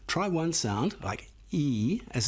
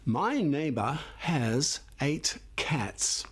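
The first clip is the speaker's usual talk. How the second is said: slow title; slower than usual. Shown as a demonstration. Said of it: "how about this title?".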